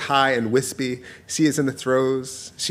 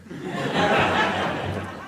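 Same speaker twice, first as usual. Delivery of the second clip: breathy laugh; 5 dB quieter than usual. Real, not acted. Laughs at own joke.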